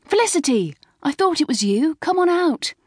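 high, eager schoolgirl hail; rising, high-pitched excitement; excited calling. A narrator's reading. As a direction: call a friend out of the car. Friend calling Felicity out of car.

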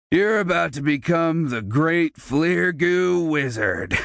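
bully mocking the cornered boy. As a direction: taunt him with a fake title. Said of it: "Derek mocked".

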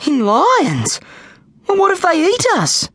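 high, rising squeaky worry; squeaky panic. Acted. Snag frets to the trainer, squeaky alarm. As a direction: squeak with growing fear. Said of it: "squeaked Snag".

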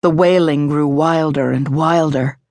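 plain reading voice

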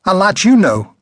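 high, loud scornful retort; scornful old woman. A narrator's reading. Old woman snapping back at the man beside Darwin, high and loud. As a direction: brush him off with contempt.

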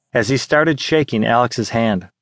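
ordinary delivery, nothing marked

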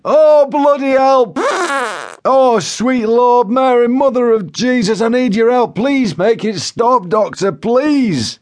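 very high, drawn-out desperate wail; Joe Windass pleading. A narrator's reading. Joe begs the paramedic to make it stop, wailing desperately. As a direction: beg for mercy at the top of the range. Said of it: "he pleaded".

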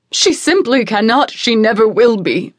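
loud, firm insistence; insistent mother. Acted. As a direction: refuse firmly.

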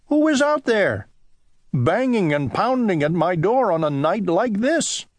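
high, alarmed call at the door; startled Owl. Acted. Owl alone at night, calling to the door, alarmed.

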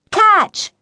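high, loud one-word call; sharp high-pitched holler. A narrator's reading. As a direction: shout a warning as you kick the ball. Girl calls out booting ball.

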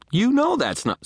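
high, loud indignant protest. A narrator's reading. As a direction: burst out in objection. Protest cut off by friend.

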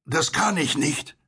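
high, halting voice that stops mid-sentence; hesitant, breaking off. A narrator's reading. Unseen stranger in a boat refusing, hesitant and breaking off. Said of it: "Die Stimme brach ab".

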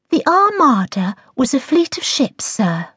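high-pitched know-it-all pupil, falling on the last word; self-satisfied schoolgirl answer; smug. A narrator's reading. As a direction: recite it smugly to the teacher. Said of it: "Laura said in a smug voice".

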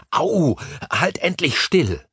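slow, exasperated order through mild pain; pained exasperation. A narrator's reading. Harry to the kicking girl he is holding, pained and exasperated. Kicked man scolds struggling child.

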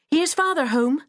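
high, bright call across the room; a boy calling that his father is home. A narrator's reading. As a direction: call out to the house. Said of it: "Willie called".

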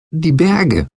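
put-on high child's exclamation; calling out. A narrator's reading. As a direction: call out in Betsy's high voice. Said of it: "rief Betsy".

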